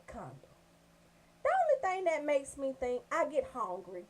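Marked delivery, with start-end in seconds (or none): none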